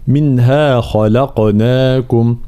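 منها خلقناكم with a heavy خ, a light ل and an echoing ق; slow recitation of منها خلقناكم; tajwid demonstration. Shown as a demonstration. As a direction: recite خلقناكم slowly, lips rounded on the final كم. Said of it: "الخاء مفخمة واللام مرققة".

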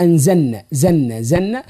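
anzanna, with no L; dropped lam in anzalna. Shown as a demonstration. Teacher mimicking the mistake, L dropped from anzalna. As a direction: swallow the L of anzalna into the N. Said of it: "ينحذفوا حرف اللام".

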